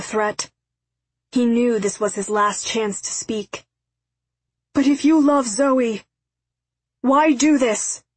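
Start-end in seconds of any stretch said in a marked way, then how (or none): 4.7-8.0 raised, louder pleading voice; desperate appeal. A narrator's reading. Father challenges X before being killed, desperate appeal. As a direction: plead with him, high and urgent.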